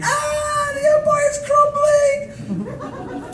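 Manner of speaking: high drawn-out hammed-up scream; mock panic. Imagined Amsterdam pedestrian fleeing legal hash, mock panicked scream. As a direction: wail it in exaggerated terror.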